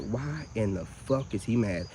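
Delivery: slow, very soft, muttered under the breath; hushed disbelief. Retold or imitated. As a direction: mutter it to yourself, baffled and slow. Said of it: "And I'm like".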